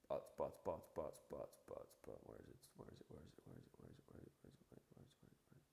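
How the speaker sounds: trailing mumble, fading almost to nothing; muttering to himself. Real, not acted. Searching his screen for the quote, muttering softly to himself. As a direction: mumble under your breath while hunting for something.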